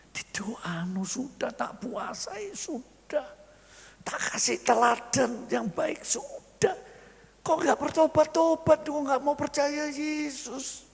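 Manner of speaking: high, strained plea of a worn-out wife; despairing lament. Acted. Wife complains to God about her husband, high despairing wail.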